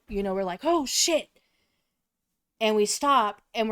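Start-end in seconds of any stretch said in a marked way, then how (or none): 0.6-1.3 high, startled reaction; a retold scared gasp of a curse; startled oh shit. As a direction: jump up in pitch as if spooked. Spooked reaction to a shadow.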